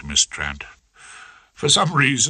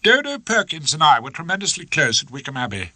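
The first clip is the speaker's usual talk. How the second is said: cold; 7 semitones higher than usual. Acted. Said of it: "said Hilda coldly".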